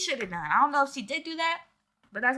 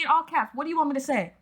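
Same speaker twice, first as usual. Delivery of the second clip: normal talking voice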